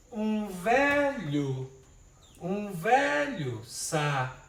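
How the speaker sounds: very drawn-out, beat by beat; slow syllable scansion. Shown as a demonstration. Teacher slowing the verse to show the syllable merge. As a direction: pull each syllable apart slowly. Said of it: "ó aqui, aí já, já mudou aqui".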